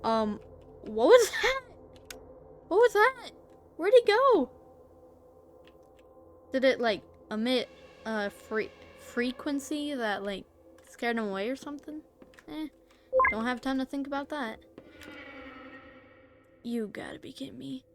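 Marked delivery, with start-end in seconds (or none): 0.8-4.5 panicked high questions; startled, high and loud. Real, not acted. Startled by monster. 16.6-17.8 whispered. Real, not acted. Annoyed under breath.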